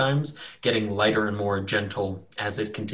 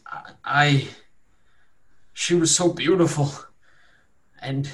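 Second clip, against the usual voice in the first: higher, stammering, halting recollection; dazed captive; 4 semitones higher, 3 dB louder than usual. Acted. Wounded captive remembers a woman, halting and dazed. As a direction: trail through the memory in broken pieces.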